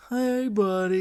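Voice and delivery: high, silly sing-song buddy voice; chirpy cartoon narwhal. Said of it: "it sounds like the narwhal being like".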